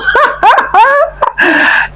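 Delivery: high-pitched burst of laughter; real laugh. Real, not acted. Vlogger amused at a celebrity claiming Nigerian roots, laughing.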